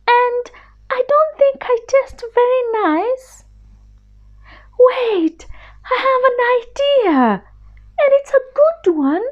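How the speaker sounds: a tiny scared mouse begging pardon; little mouse voice. Acted. Mouse pleads with lion.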